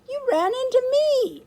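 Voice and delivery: put-on very high, loud child's retort; indignant protest. A narrator's reading. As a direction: protest loudly at being blamed. Bumped girl protests to boy.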